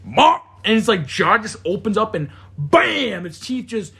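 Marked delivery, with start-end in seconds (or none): 0.0-0.4 high, loud mock bark; imitating the dog lunging; dog growl bark. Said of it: "he just goes".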